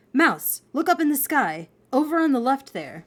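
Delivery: lightly excited pointing-out; calling attention. Acted. Chuck pointing out the sky writing.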